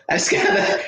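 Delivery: airy high laugh at the memory; breathy laugh. Real, not acted. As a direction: laugh it off, amused in disbelief.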